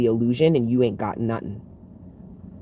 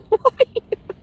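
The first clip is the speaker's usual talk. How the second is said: high, loud squeal of a laugh; real high-pitched cackle; 7 dB louder than usual. Real, not acted. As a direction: burst out in a shrieking laugh. Laughing at the irony.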